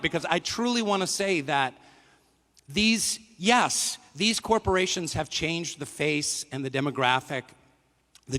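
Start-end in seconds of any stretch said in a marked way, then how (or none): none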